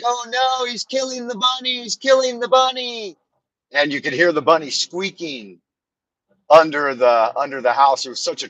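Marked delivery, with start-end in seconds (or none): 0.0-3.2 high, wailing mock child; crying kid. Little brother wailing as the cat takes the bunny. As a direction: imitate a sobbing little boy. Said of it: "my brother was yelling and crying".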